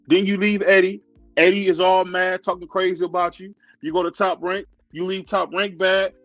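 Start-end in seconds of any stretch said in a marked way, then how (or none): none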